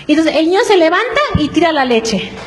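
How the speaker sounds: usual speaking voice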